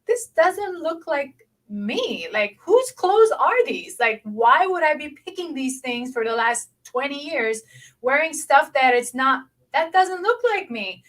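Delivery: retold disbelief. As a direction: act out baffled self-questioning, stretching the opening words. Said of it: "looking in my closet and I'm like".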